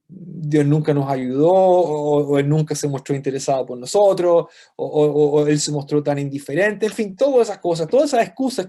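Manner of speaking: loud preaching to the congregation. Acted. Preacher rebuking excuses to his congregation, in a loud preaching voice. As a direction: drive it home from the pulpit.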